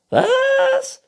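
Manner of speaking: very high, loud squeak; shouting squeaky raccoon; hard-of-hearing raccoon. A narrator's reading. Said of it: "Du musst lauter reden". (schwerhöriger Waschbär, hoch und laut)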